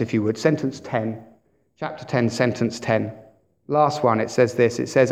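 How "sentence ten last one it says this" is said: ordinary talk, nothing marked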